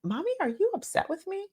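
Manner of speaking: put-on high, quick child's worried question; young son's voice. Son in the back seat asks his mom, in a high, quick child voice. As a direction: mimic a little boy asking if mom is mad. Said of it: "he said".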